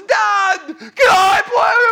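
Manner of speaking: put-on very high, drawn-out kid shout; loud whiny begging, put on; pestering son. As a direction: imitate a child pleading at full volume. Son interrupts again, pleading.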